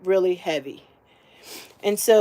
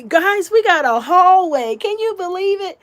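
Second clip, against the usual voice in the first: excited; 9 semitones higher, 4 dB louder than usual. Real, not acted. Showing off the new hallway.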